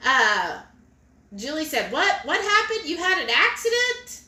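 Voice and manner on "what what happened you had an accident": high-pitched startled questions, acted out; alarmed surprise. Host reading Julie's comment about an accident, acted alarm. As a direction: read it as shocked concern, voice jumping up.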